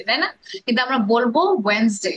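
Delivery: ordinary delivery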